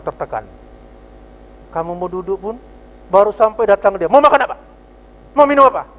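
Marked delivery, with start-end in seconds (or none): 4.1-5.9 loud, higher, rushing barked order; impatient waiter demanding the order loudly; rude waiter. Retold or imitated. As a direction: snap the order at the diner like a harried waiter. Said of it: "ini orang ganas betul ini".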